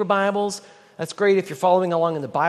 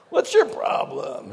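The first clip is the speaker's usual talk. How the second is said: slow, higher mock snarl of a Texan shopper; rude Texan shopper; 8 semitones higher, slower than usual. Texan shopper snapping at someone blocking the aisle, mock rude. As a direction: sneer it like an annoyed Texan. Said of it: "in a Walmart in Texas".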